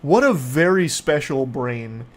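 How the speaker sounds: ordinary delivery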